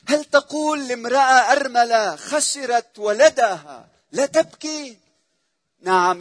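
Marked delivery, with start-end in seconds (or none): 0.1-5.0 louder, higher, pressing the question; a preacher's loud rhetorical question; rising indignant challenge. Acted. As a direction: demand it of the crowd.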